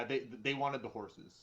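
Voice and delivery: usual speaking voice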